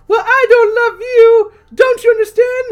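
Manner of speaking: high, loud shrieking heroine; shrieking lady. Acted. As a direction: scream it like a terrified damsel. Lady rejects monster, shrieking.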